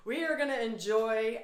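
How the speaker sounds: usual speaking voice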